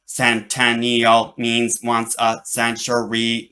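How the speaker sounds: slow, even machine voice; robot voice. Acted. Robot corrects Charlie, slow and mechanical. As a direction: explain it like a patient computer. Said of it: "the robot said".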